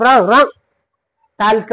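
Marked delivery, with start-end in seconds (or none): 1.4-1.7 "टाल्क" with a clear L before the K; wrong pronunciation. Shown as a demonstration. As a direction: sound the L and final vowel in "टाल्क". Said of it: "म्हणायचं नाही". ("टाल्क", ल उच्चारून)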